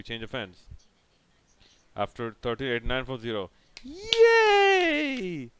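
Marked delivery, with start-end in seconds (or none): 3.8-5.5 high mock cheer; sarcastic cheer. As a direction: fake excited yay. Sarcastic cheer at two-run over.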